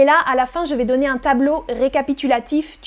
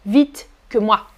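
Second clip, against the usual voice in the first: usual speaking voice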